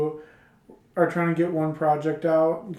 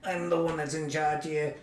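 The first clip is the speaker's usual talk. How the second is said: mock Missus Lobo, softer; 7 dB quieter than usual. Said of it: "this is my impersonation of her".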